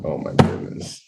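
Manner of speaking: whispered under the breath; low whispered groan at the coming joke; whispered dread. Real, not acted. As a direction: mutter it in dread. Reacting to joke setup.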